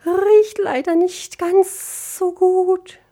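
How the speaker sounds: very high, slow creature voice; squeaky dragonfly complaint; little dragonfly voice. Acted. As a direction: draw it out high and loud. Dragonfly answers in figure voice.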